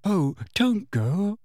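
a slow, tearful plea to stay. A narrator's reading. Cho begs Harry not to leave, slow and tearful. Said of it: "sounding tearful again".

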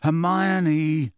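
mildly indignant character voice; understated exasperated protest; low indignation. A narrator's reading. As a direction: draw the name out in mild indignation. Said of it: "said Ron in a low and indignant voice".

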